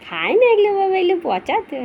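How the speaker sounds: high loud shoo; shooing the dog. Real, not acted. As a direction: shoo the puppy away, loudly. Shooing dog, food is bad.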